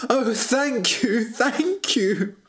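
high, drawn-out cry of relief; weepy, grateful prince voice; tearful gratitude. A narrator's reading. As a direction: cry your thanks, overcome with relief. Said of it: "cried the prince".

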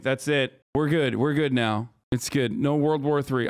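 normal talking voice

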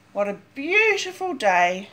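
ordinary delivery, nothing marked

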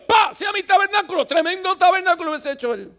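mock proud God shout. God's imagined boastful words.

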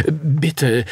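beg, voice lifted; pleading. A narrator's reading. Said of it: "flehte der Kaufmann".

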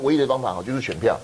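ordinary talk

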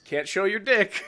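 playfully panicked protest; mock freaking out. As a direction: blurt it like a flustered prude, hammed up. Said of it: "it's Poe who's freaking out".

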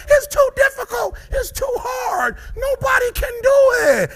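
very high, loud mock whine; hammed-up complaining quitter; whiny excuse-maker. Speaker mocking people who give up, high whiny shout.

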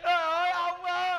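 high, hammed-up wail; mock funeral lament; fake crying. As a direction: wail as if mourning your grandfather. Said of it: "Khóc vậy?".

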